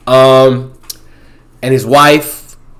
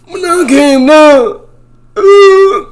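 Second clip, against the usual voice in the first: high, slow strained squeal ending in a groan; imitating fall video; 7 dB louder, much slower than usual. Guy falling off milk crates, high pained squeal. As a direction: squeak it out like you just landed hard. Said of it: "and he's like".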